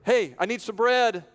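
calling out for bread. Acted. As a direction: call up to the window for bread. Friend knocking for bread at night.

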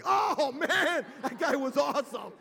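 high, loud, laughing awe; awed excitement. Retold or imitated. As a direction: cheer for a wild grandpa. Grandkids admire the crash.

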